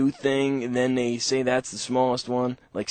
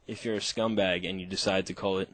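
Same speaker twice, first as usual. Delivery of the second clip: usual speaking voice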